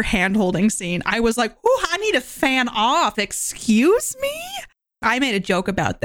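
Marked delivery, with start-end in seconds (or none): 1.6-4.4 high-pitched, mock-scandalized reaction; playfully overheated, rising high into a slow, incredulous question; flustered swoon. Viewer reacting to a steamy hand-holding scene, flustered.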